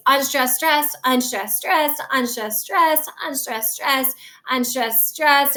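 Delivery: repeated "unstressed, stressed" pairs in a steady beat; "unstressed, stressed" as a metronomic demonstration of five beats; iambic rhythm. Shown as a demonstration. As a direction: chant out "unstressed, stressed" slowly, pulsing on "stressed". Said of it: "it's five iambs".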